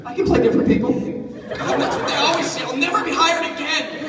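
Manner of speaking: high, loud laughing lament; giggling melodrama; laughing mock despair. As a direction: play up the doom with laughs. Joking despair about being recast.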